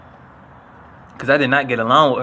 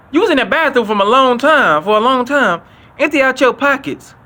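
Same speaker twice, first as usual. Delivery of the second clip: mock stern teacher; 10 semitones higher, 4 dB louder than usual. Teacher confronting student from bathroom.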